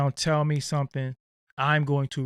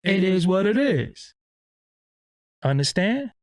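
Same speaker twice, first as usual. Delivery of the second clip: put-on voice; 5 semitones higher, 6 dB louder than usual. Shown as a demonstration. Shows off voices on stream.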